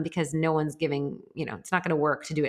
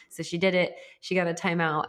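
usual speaking voice

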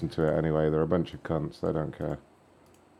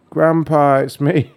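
tearful muffled scam call; 7 semitones higher, 5 dB louder, slower than usual. Pretend. Prank call pretending to be grandson.

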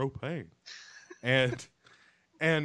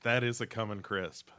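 mock savoring; 2 semitones higher, 5 dB louder than usual. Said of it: "you're like".

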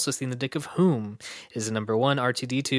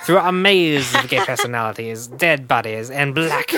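louder, hammed-up fake Irish read breaking into laughter; campy mock Irish; 6 dB louder than usual. Host reading the movie summary in a terrible campy Irish accent. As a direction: camp it up in a terrible Irish brogue. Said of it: "That wasn't Irish at all. That was just campy".

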